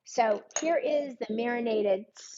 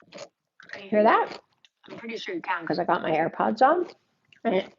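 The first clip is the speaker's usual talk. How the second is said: talking while crunching a cucumber. Real, not acted.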